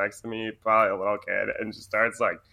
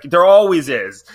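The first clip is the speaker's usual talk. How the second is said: exasperated mom; 6 semitones higher, 13 dB louder than usual. Retold or imitated. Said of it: "she's like".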